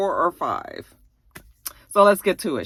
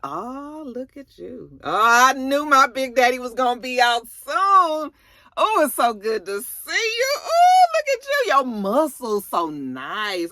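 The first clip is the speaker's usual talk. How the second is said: high, breathy-sounding gush that climbs much higher on "look at you"; gushing girlfriend; 7 semitones higher, 4 dB louder than usual. Retold or imitated. Old flame fawns over Big Ron's return, mock gushing. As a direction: swoon over him like a lovestruck girl.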